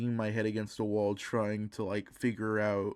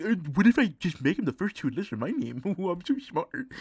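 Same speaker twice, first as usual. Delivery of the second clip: put-on smug kid voice; 8 semitones higher, 4 dB louder, faster than usual. Retelling his younger self's naming idea.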